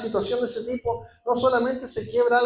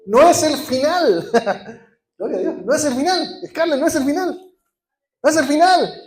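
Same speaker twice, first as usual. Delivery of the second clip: loud, rising pulpit proclamation; preaching at full force; 5 semitones higher, 5 dB louder than usual. Acted. Pastor lifting the congregation, loud and rising. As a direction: hammer the promise home to the congregation.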